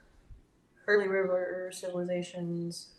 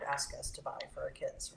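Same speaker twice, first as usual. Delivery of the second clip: whispered aside; 4 semitones lower, 8 dB quieter than usual. Real, not acted. Complains about the teacher.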